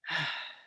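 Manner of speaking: long, soft exhaled sigh; demonstrated breathing-out sigh; audible sigh of release. Shown as a demonstration. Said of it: "breath with an audible release".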